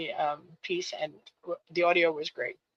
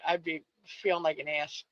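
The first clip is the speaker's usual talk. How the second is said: normal talking voice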